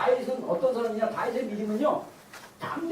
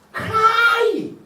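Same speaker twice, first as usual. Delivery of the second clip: drawn-out, high loud cry; crying out for help, stretched slowly; demonstrated cry; 8 semitones higher, 5 dB louder, much slower than usual. Shown as a demonstration. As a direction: call it out long and high. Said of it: "도와달라고 소리 지는 거예요".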